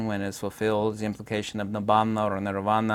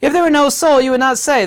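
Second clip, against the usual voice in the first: much higher, loud insistence; exasperated argument; 13 semitones higher, 9 dB louder than usual. Real, not acted. Lecturer refuting the no-soul doctrine, loud and exasperated. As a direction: hammer the point at a raised pitch.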